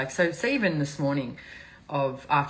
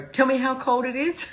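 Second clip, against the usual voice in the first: higher, louder mock-scoffing friend; mocking Europeans; 10 semitones higher, 4 dB louder than usual. Imitating European friends scoffing at her cold, higher and teasing. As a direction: tease like an unimpressed European friend. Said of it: "everyone I know in Europe's gonna go".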